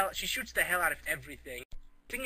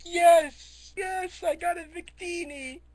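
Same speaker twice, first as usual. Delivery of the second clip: very high, put-on gleeful voice; mock triumph; 14 semitones higher, 8 dB louder, slower than usual. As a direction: squeal it like an overjoyed gamer. Character rejoices over Pokemon.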